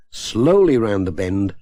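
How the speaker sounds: ordinary delivery, nothing marked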